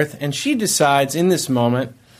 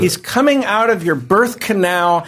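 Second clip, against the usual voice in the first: higher, heated re-enacted scolding; retold yelling; 5 semitones higher than usual. Husband scolding wife on hold during birth, retold yelling. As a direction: imitate arguing in exasperation. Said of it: "I'm yelling at her".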